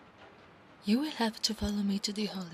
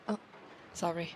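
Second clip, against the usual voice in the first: usual speaking voice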